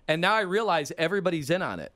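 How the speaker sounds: usual speaking voice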